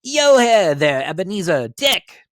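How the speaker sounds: loud, high, slow jolly call; a hearty old master calling his lads; fat jovial voice. Acted. Fezziwig calls his apprentices, jovial hearty call. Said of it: "comfortable, oily, rich, fat, jovial voice".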